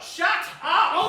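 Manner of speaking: sudden loud yell; shouting. Acted. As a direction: bark it to silence him. Mother snaps at squabbling son.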